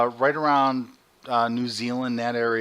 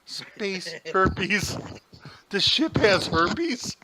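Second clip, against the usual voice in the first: mock trailer voice; 8 semitones higher, much slower than usual. Movie trailer parody.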